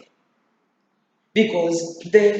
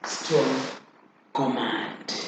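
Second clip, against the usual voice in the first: ordinary talk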